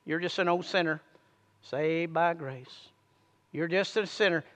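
low quiet sneering accusation; voice of the flesh. Retold or imitated. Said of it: "what does the flesh say".

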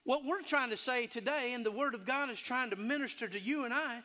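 impassioned sermon voice; preaching. Acted. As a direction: preach it to the congregation. Preacher holds sermon delivery.

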